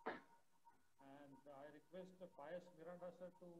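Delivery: very faint, far from the mic; speaking too softly to be heard; barely audible. Real, not acted. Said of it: "we cannot hear you".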